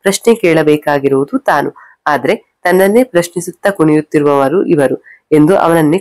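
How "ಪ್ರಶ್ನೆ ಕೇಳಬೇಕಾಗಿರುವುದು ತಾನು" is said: plain reading voice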